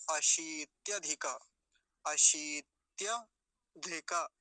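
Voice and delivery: very slow, broken into parts; deliberate syllable-by-syllable demonstration; drawn-out model. Shown as a demonstration. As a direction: stretch each piece of the word. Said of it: "ऐसा हो जाएगा".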